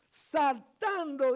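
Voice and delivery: loud, high, stretched-out proclamation; slow, ringing pulpit cry; emphatic preaching. Real, not acted. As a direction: stretch the word out and project it. Preaches at full force.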